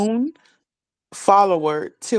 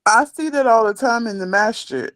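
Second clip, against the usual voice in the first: ordinary talk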